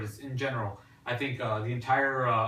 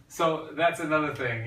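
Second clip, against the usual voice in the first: ordinary delivery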